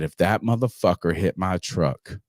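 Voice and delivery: low, deliberate threat; acted-out low warning; retold threat. Retells threatening his friend.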